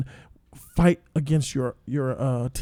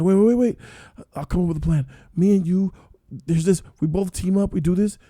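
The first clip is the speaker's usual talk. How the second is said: urgent plea; 4 semitones higher, 4 dB louder than usual. Retold or imitated. 218 pleading with one marble left.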